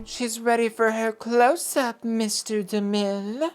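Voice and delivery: slow, breathy-free grand film star line; old Hollywood diva. Pretend. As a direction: purr it like a faded movie star. Quoting Sunset Boulevard line.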